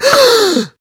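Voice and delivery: loud, high cartoon gasp; clown gasp. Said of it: "in my wacky clown voice".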